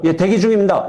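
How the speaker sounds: mock eager trainee; dutiful trainee reply. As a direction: answer promptly like a trainee reporting. Acted trainee answering a senior monk.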